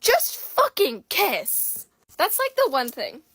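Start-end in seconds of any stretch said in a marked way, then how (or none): 0.0-1.8 exasperated plea. Retold or imitated. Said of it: "I'm like".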